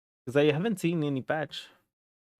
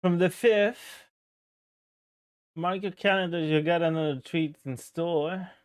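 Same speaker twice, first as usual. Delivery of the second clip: announcer voice; 6 semitones higher than usual. Acted. Reading announcement in ad voice.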